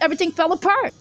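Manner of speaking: ordinary delivery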